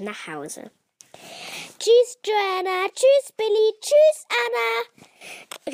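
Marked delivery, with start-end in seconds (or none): 1.8-5.7 high, loud farewell calls; called-out goodbye. A narrator's reading. Girl calling to friends as they leave, loud goodbye. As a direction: call after each departing friend, high and drawn out. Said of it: "rief Luisa zum Abschied".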